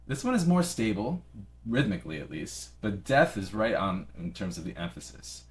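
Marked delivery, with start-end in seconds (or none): none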